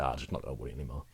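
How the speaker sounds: low, quick, offhand brush-off; dismissive shrug. Retold or imitated. Mocking the idea that you can just stop worrying, low and quick. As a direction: toss it away lightly like it's easy. Mock self-talk of giving up worrying.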